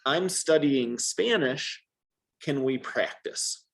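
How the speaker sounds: slow, careful model sentence; slow model phrase. Shown as a demonstration. Teacher slowly models an intro phrase for Jeff. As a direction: lay it out slowly for a learner to copy. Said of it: "here's the best way".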